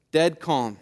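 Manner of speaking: usual speaking voice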